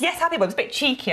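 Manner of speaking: giggly, cheeky delight; amused tease. Real, not acted. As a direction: play up the naughty joke, amused. Host teasing about cheeky ad name.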